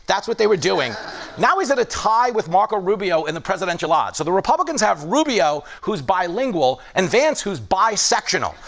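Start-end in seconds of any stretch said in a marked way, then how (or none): none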